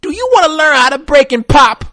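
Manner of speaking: high mock commercial pitch; hammed-up kid ad voice; imitating Alfonso Rivera. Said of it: "Alfonso Rivera's ass comes on".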